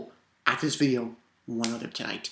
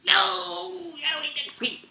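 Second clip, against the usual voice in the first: high, slow yell of frustration; excited shout; 13 semitones higher, 4 dB quieter, slower than usual. Real, not acted. Fan begging the next chapter to come now, shouting. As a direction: shout it, desperate for more. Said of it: "I was like".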